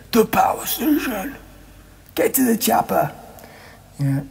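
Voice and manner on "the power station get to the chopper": high, far-off action hero shout; action movie hero voice. Retold or imitated. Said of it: "it goes".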